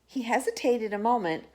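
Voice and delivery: usual speaking voice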